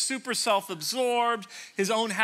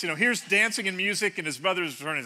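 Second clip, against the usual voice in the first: ordinary talk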